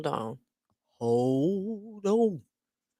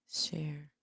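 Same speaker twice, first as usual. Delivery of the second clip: whisper; 13 dB quieter than usual. Real, not acted. Muttering at screen share.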